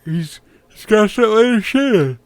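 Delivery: words dragged out through a yawn. Real, not acted. Commenting on the match to viewers, yawning through it. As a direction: yawn through the whole line. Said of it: "I'm sorry I'm yawning so much".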